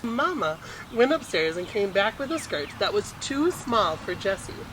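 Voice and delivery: usual speaking voice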